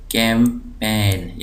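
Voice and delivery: Campaign slow, in two parts; syllable by syllable. Shown as a demonstration. Teacher dividing the word for students, slow split syllables. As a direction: split campaign into कैं, pause, पेन. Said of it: "इसको ज़ोर दिया जा रहा है".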